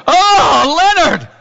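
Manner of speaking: high, loud, drawn-out acted realization; sudden recognition. [name] on the phone remembering Leonard, loud acted surprise. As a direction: exclaim it as it all comes back.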